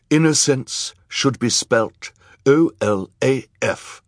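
showy villain's proclamation; self-important villain. A narrator's reading. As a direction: spell it out grandly, savouring each letter. Olaf spells out his name.

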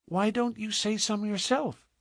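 put-on high, soft little-girl question; piping small voice, curious; wondering child. A narrator's reading. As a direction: ask in innocent puzzlement. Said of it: "asked with wondering eyes".

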